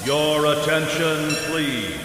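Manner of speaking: ordinary talk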